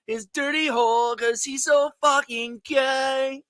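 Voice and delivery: high, very slow put-on voice; mock fan voice. Joining the bit against his co-host, high and very slow. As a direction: play the fan back, high and stretched out. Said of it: "He liked your voice".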